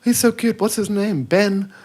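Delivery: high-pitched [name]. Retold or imitated. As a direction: squeal it up in falsetto. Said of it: "his high-pitched voice".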